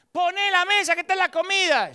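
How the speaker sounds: high, insistent second call; impatient parent call. Retold or imitated. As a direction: press the kid a second time.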